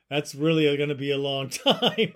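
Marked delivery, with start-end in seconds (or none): none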